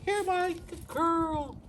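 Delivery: high squeaky praise for the dog. Acted. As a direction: squeak it up and slow, praising a puppy.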